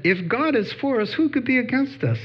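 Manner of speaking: bright and high, quoting eagerly; eager scripture quote. Retold or imitated. Witnessing to Mike in the store, eager and high. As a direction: share the verse with real eagerness. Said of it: "I'm just witnessing to him".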